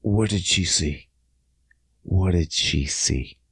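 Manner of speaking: low, slow, hushed question; worried muttering. Retold or imitated. Man alone after she closes door, hushed worry. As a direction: ask yourself quietly, low and slow.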